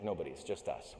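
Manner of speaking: low soft sheepish admission. Retold or imitated. Climber sheepishly admitting to his sponsor that nobody sees the logo. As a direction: admit it meekly.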